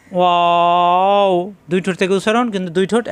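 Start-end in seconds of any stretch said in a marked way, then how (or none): 0.1-1.3 ওয়াও stretched long on a level note; ওয়াও, sustained slowly; drawn-out letter name. Shown as a demonstration. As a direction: hold the vowel of ওয়াও, rounding at the end. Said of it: "এখানে কিন্তু টান দেওয়া যায়".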